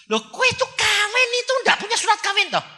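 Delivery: high, loud shocked question. Retold or imitated. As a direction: exclaim in disbelief. Preacher shocked at couple. (kaget dengan suara tinggi)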